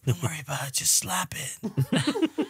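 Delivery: imitating a hushed, soft egging-on; sly whisper. Younger brother egging on the older one, sly hush. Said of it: "this is how I picture it".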